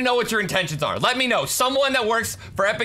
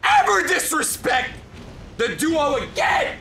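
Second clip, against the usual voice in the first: angry yell; 4 semitones higher than usual. Real, not acted. Yelling at opponent.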